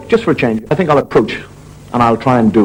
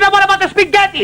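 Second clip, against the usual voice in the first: high hammed-up Italian wife. Wife calling after her husband, high comic Italian accent. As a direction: plead like a comic Italian mamma. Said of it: "She said".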